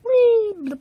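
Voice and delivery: high loud playful whee; gleeful whee. Pretend. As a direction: squeal it like a slide. Toy penguin slides into water.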